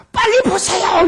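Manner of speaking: high loud urgent shout. Retold or imitated. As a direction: bark it out urgently like a panicked nurse on the phone. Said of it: "이렇게 막 소리 질르는 거예요". (다급하게 소리치는 간호사)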